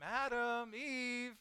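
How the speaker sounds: calling out. Acted. God calling for Adam and Eve, drawn-out calling. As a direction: sing out the names for someone hiding. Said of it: "like, calling for them".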